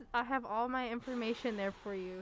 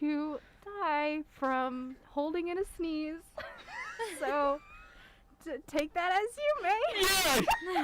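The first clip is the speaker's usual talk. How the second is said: laughing; 6 semitones higher, much slower than usual. Real, not acted. Laughing through a morbid fact.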